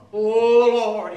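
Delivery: loud, drawn-out "Oh"; retold crying out. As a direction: cry out like the boy answering God. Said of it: "he heard the voice and he cried out".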